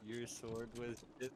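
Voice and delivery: normal talking voice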